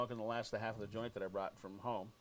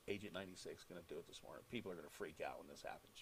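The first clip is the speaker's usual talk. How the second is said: much softer and lower, fast secretive tease; hushed teaser; 8 semitones lower, 12 dB quieter, faster than usual. Retold or imitated. Host teasing listeners about the stunt, hushed and quick. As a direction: hush it like a secret for the audience.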